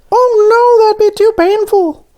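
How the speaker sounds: squeaky high cartoon voice; high donut voice. A narrator's reading. Voices Arnie.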